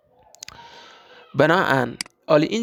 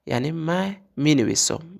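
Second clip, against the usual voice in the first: ordinary delivery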